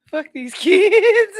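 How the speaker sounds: loud, high, laughing outburst; cracking up. Real, not acted. Podcast host reacting to kids' drive-thru pranks, cracking up. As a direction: drag it out loudly through real laughter.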